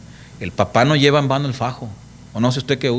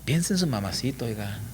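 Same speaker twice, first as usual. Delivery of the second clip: soft, lower mock-tender plea; mock gentle plea; 4 semitones lower, 11 dB quieter than usual. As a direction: coax a criminal with sarcastic softness. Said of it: "Imagínate decirle a un violador".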